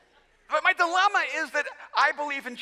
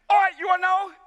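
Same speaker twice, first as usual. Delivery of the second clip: louder, high-pitched giving in; exasperated outburst; 8 semitones higher, 5 dB louder than usual. Retold or imitated. Dad about to confess to his son, exasperated outburst. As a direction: blurt it as if finally pushed too far. Giving in during retold spat.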